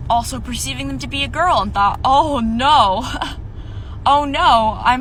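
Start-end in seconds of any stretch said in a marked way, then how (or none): none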